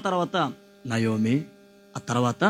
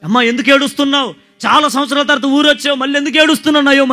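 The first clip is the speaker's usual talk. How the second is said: higher, louder, fast concerned questioning; townsfolk asking; 8 semitones higher, 8 dB louder, faster than usual. Acted. Villagers asking crying Naomi why she weeps, high and loud. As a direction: press her kindly about her tears.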